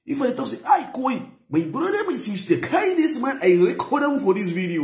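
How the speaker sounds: high mock Hausa voice; Hausa accent. Shown as a demonstration. Vlogger demoing fake Hausa voice over his own, high. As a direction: put on a high Hausa voice to fool listeners. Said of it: "try the format to be Hausa language".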